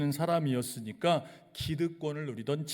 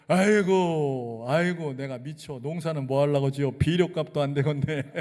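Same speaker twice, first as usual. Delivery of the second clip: Chungcheong countrywoman complaining about fertilizer costs, louder, mock despair; Chungcheong lament; 7 dB louder than usual. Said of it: "충청도 화법".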